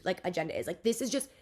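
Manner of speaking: usual speaking voice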